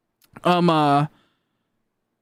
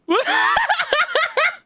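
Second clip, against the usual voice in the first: high burst of laughter. Real, not acted. Laughing at sudden raid.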